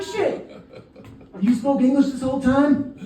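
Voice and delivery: high-pitched disbelief; shocked realization. Retold or imitated. Tourist learns vendor spoke English, shocked realization. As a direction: jump way up in stunned surprise.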